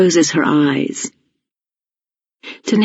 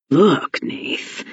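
mumbling; 4 dB quieter than usual. A narrator's reading. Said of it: "Jack mumbles".

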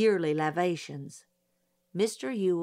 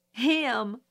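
mild sob; 5 semitones higher, 4 dB louder than usual. Acted. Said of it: "she sobbed".

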